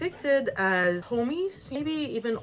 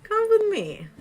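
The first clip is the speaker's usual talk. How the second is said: much higher, louder summons; beckoning old woman; 13 semitones higher, 5 dB louder than usual. Acted. Old woman leads Ivan off to help, high beckoning call. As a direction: call him along in a granny's raised voice.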